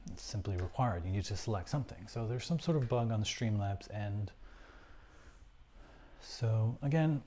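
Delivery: ordinary talk, nothing marked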